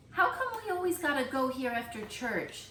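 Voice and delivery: put-on a small boy grumbling at the rain; lightly voiced curious kid; young boy CJ. CJ complains.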